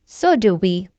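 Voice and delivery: usual speaking voice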